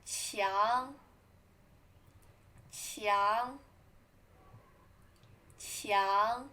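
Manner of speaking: 强 rising upward; 强 with a steady rising tone; second tone 强. Shown as a demonstration. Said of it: "Second tone".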